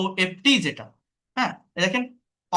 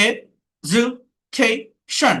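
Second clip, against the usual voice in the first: "Education" in four slow, separate beats; split into syllables; 3 semitones higher, 4 dB louder, much slower than usual. Shown as a demonstration. Teacher counting four syllables, "Education" slowly split. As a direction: break "Education" apart with a pause after each syllable. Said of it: "four syllable".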